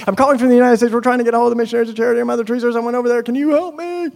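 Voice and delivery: high, fast, loud phone spiel; racing against an expensive phone call; rushed. Retold or imitated. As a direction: rattle it all out in one hurried breath. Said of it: "Okay, I'm gonna make this quick".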